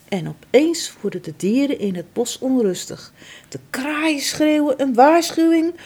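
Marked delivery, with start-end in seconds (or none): none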